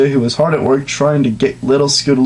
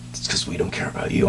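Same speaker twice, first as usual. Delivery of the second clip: whispered teasing aside; joking whisper; 10 dB quieter, faster than usual. Pretend. Reader teasing his co-reader, whispered joking aside. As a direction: whisper it behind a hand as a joke.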